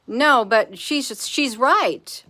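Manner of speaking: usual speaking voice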